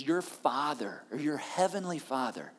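slow hushed reverence on Father; intimate. Shown as a demonstration. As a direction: say it like a whispered endearment. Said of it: "He uses this intimate term".

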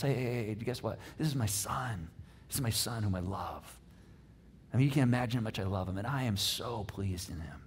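low, hushed, loving pride; tender fatherly declaration. Acted. God telling everyone about his son, softly tender.

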